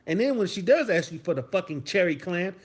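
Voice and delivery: ordinary talk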